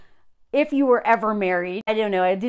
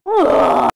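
loud, higher-pitched "ugh"; real exasperated disgust; disgusted groan; 6 semitones higher, 10 dB louder than usual. Real, not acted. Disgust at the pretentious writer.

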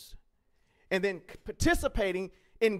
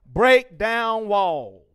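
slow emphatic slogan; 8 dB louder, much slower than usual. Shown as a demonstration. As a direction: Break down walls drawn out very slowly, each word hammered. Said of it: "Or you can say it like this".

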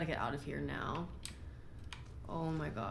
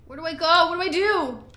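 high, loud frantic questions; panicked cry; 12 semitones higher, 12 dB louder than usual. Real, not acted. Gamer panicking in the cave, shouting frantically. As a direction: shout in a panic, lost and scared. Panicking in scary game scene.

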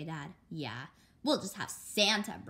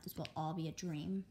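ordinary delivery

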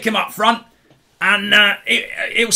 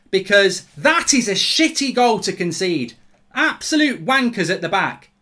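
raised, exasperated post-goal rant; worked-up told-you-so anger; angry rant; 5 semitones higher than usual. Real, not acted. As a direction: vent at the defence, hammering each phrase. Ranting after conceded goal.

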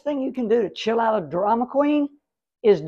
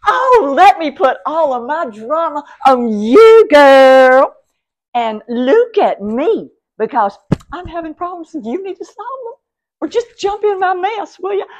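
loud, whiny drama queen dumping her problems on you; drama queen impression; 6 semitones higher, 10 dB louder than usual. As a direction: ham up a needy drama queen, high and loud. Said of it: "because they want to go".

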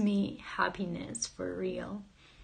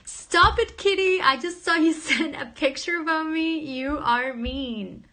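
much higher and louder, laughing at the kitty; playful scolding; 7 semitones higher, 7 dB louder than usual. Real, not acted. Streamer scolds her cat for sending a picture, high and loud. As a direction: tease the cat like it betrayed you.

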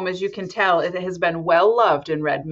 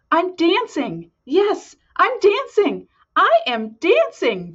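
much higher, thrilled squeals; excited joyful cries; 10 semitones higher, slower than usual. Acted. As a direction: burst out with pure joy. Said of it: "Gerald cried".

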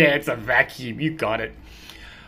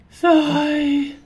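usual speaking voice

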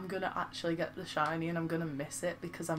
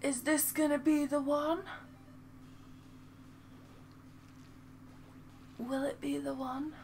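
high, rising hopeful questions; playful suspense; 8 semitones higher than usual. Pretend. Streamer watching an egg hatch, high playful suspense. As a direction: lift it high, hanging on the question.

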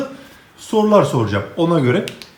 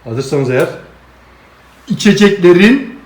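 ordinary talk

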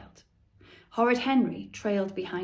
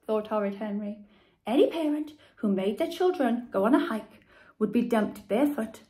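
ordinary delivery

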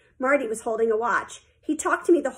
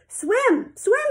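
high, urgent call; 11 semitones higher, 3 dB louder than usual. A narrator's reading. Coach calling to Sheila in the pool, urging. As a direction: call across the water, second one higher. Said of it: "he called".

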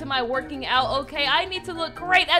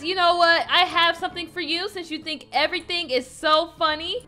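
normal talking voice

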